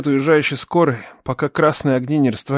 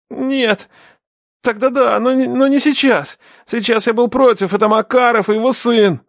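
high, pleading protest; panicky denial; 9 semitones higher, 3 dB louder than usual. A narrator's reading. Captive denies his part to the father, panicky. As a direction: protest desperately, pitched well up.

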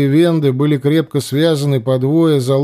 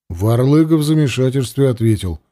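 usual speaking voice

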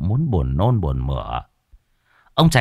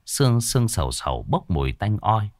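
ordinary delivery, nothing marked

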